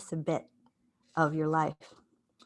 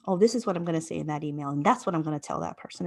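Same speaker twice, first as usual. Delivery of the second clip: rushed mental rehearsal; 3 semitones lower, much faster than usual. Retold or imitated.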